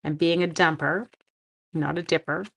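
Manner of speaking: talking with tongue sticking out. Real, not acted. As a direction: speak around a poked-out tongue. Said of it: "My tongue is sticking out so badly right now".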